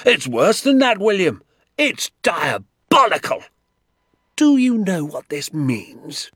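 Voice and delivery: high-pitched, loud indignation; outraged rant. A narrator's reading. Doctor telling father what the traps mean, outraged. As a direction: keep raging, hammering out each point. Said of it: "jumping up and down with fury".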